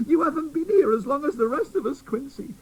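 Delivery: fairy scolding the new doll, high; tattered fairy. Acted. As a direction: worry at him. Fairy Griselda speaks.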